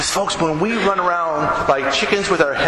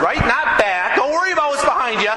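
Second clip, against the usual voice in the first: normal talking voice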